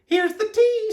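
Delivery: very high character voice; Amelia Bedelia. A narrator's reading. Amelia Bedelia serves the guests, very high voice. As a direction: announce it way up high. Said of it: "said Amelia Bedelia".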